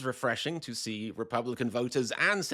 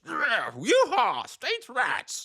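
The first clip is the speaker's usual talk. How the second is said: loud, high, drawn-out cowboy holler; yeehawing partisan; 11 semitones higher, 4 dB louder, much slower than usual. Acted. Republicans cheering for their cause, hammed-up yeehaw. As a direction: whoop it like a mock rodeo cowboy. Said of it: "being the party of".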